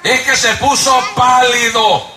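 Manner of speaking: high, slow preacher's shout; shouted sermon line; fiery preaching. Acted. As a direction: preach it out with full force. Preaching at full force.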